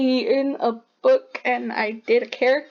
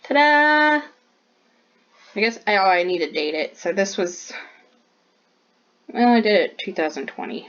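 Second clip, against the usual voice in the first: ordinary talk, nothing marked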